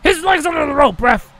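high, loud pleading call. Real, not acted. Gamer calling his partner Eddie and the ref, high loud pleading shout. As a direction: shout for the tag.

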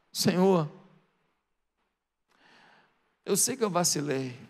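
low, softened, remorseful admission; tearful confession. Acted. Peter owning his failure to Jesus, subdued and tearful. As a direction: confess quietly with long pauses, mildly tearful. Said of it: "já com lágrima nos olhos".

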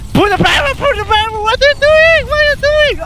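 high, loud exasperated shout; rising panicked call to the team; frantic yelling. Real, not acted. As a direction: yell at a teammate who messed up. Yelling orders at teammates.

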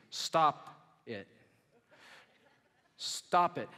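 firm, emphatic two-word order; stressed, falling command; blunt retort. Retold or imitated. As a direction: punch out the command as a comic psychologist. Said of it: "Here's what I wanna say to you".

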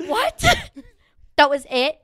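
high, loud mock disbelief; exaggerated high-pitched shock; incredulous outburst. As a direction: blurt it out as if stunned. Retold shock at test being over.

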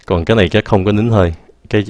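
normal talking voice

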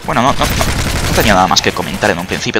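ordinary delivery

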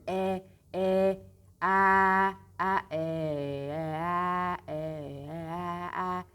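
loud drawn-out e and a vowels; open e against a. Shown as a demonstration. Teacher contrasting open e with a. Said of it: "Açık E derken ağzımız açılıyor".

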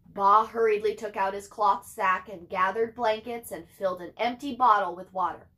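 ordinary delivery

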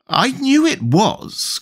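high, drawn-out storybook tantrum; furious cry. A narrator's reading. Tweedledum stamping over his rattle, high furious cry. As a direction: wail it slowly at a high pitch. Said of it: "cried Tweedledum".

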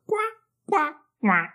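high-pitched quacks, spaced apart; duck quacking. A narrator's reading. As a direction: quack like a duck swimming away. (verso acuto di anatra)

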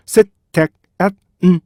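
셋, 택, 앳, 음, 제 as five separate beats; staccato syllable-by-syllable demo; syllables split apart. Shown as a demonstration. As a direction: break it into five evenly timed syllables. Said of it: "다섯 개의, 어, 똑같은 리듬".

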